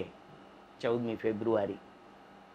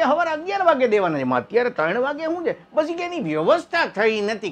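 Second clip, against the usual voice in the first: high, louder wife's voice; wife's reply; 10 semitones higher, 4 dB louder than usual. Retold or imitated. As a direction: fire it back high and quick.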